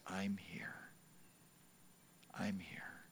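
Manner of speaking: the voice at the hospital bed, softly reassuring; whispered comfort. Retold or imitated. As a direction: hush it like a promise at a bedside. Voice that so gently held.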